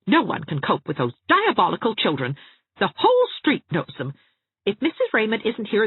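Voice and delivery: high, haughty threat; affronted matron promising trouble; huffy lady. A narrator's reading. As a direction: sweep off with offended dignity. Mrs Trent huffing off.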